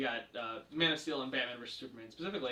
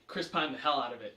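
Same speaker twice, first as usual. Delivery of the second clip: ordinary talk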